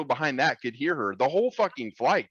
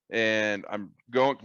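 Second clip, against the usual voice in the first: usual speaking voice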